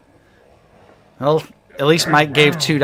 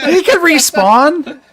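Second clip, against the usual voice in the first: laughing; 13 semitones higher, 7 dB louder, slower than usual. Real, not acted. Joking he can come back.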